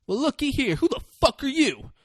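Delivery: high-pitched, taunting acted challenge; confrontational bully. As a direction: size up the newcomer with mock menace. Retold bully turns on a newcomer.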